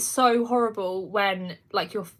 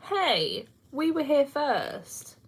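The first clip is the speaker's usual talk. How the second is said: higher, slow, playfully territorial; mock indignant; 7 semitones higher, much slower than usual. YouTube veterans teasing TikTok arrivals, mock indignant. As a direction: claim the spot like a miffed old-timer. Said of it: "And I was thinking".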